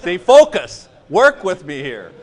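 loud, emphatic coaxing over laughter; mock-stern call to order. Teacher telling laughing students to focus, loud mock-stern call. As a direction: punch it loudly to rally a giggling room.

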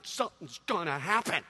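low retold suspense; hushed anticipation. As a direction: build expectation as the show begins. Retold child's suspense at concert.

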